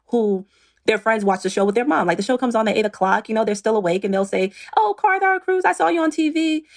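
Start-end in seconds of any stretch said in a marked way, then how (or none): 4.8-6.7 high, bright imitation of a child calling out; excited kid voice. Imitating her kids' friends spotting her on TV, high kid voice.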